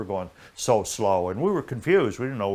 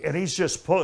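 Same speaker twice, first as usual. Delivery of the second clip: ordinary talk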